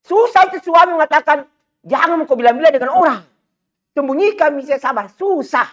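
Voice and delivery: rising preacher's fervour; fiery preaching. Acted. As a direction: preach it out high and hard to the crowd. Preaching at full force.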